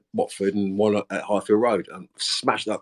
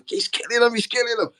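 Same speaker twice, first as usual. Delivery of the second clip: much higher, giddy squeal of glee; gleeful aside. Retold or imitated. Players watch teammates get slaughtered, imitated giddy glee. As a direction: imitate a delighted onlooker barely containing it.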